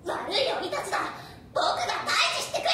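put-on loud, high boyish shout; heroic shout. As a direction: yell it like a brave boy. Momotaro vows to fight.

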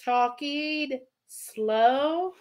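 mock slow-motion question; exaggerated slow talking. Host jokes back at viewer's comment on her talking, exaggeratedly slow. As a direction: drag every word out very slowly. Playfully answers being told she talks slower.

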